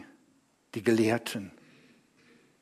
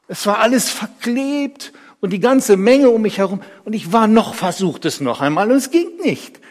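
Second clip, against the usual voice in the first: higher, agitated telling; aufgeregt; 8 semitones higher, 6 dB louder than usual. Acted. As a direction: relive the struggle, voice raised. Erzählt aufgeregtes Scheitern am Wasser. (aufgeregt erzählt)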